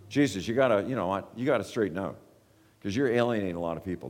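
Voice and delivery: low, fast, hushed motherly nagging; a mother pleading quietly and quickly; worried mother. Retold or imitated. Mother urging Jesus to tone it down, low and fast. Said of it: "This is what she wants to say".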